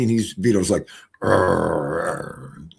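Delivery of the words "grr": low imitated dog growl; low mock doggy growl. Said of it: "gurring at them".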